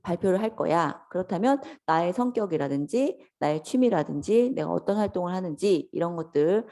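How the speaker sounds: usual speaking voice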